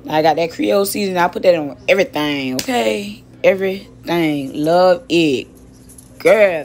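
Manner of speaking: ordinary talk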